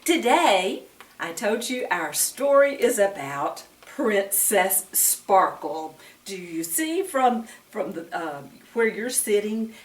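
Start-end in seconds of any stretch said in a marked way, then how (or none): none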